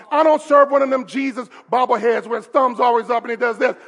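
ordinary talk, nothing marked

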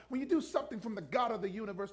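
usual speaking voice

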